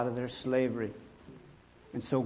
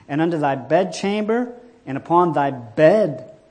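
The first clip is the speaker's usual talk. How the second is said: loud, ringing Bible proclamation; scripture reading; 3 semitones higher, 10 dB louder than usual. A narrator's reading. Preacher reading the warning of frogs, declaimed loudly. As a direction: build the list phrase by phrase, loudly.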